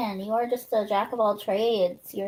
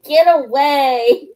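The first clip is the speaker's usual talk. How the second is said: voicing the cat; 5 semitones higher, 8 dB louder, slower than usual. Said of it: "He's like".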